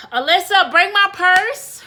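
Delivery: loud, high call across the house, slow on purse. Real, not acted. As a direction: call the assistant loudly, slowly. Calling to the home assistant.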